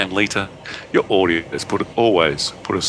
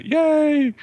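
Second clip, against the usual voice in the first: high squealing cheer; big yay. Acted. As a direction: cheer like a delighted kid. Cheering pattern in email.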